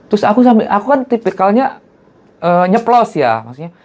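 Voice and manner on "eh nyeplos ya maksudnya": ordinary talk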